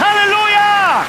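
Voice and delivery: high, loud, slow drawn-out aleluya; long hallelujah shout. Real, not acted. Preacher crowning the rally with a long, high, loud shout. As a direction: hold the aleluya out full force.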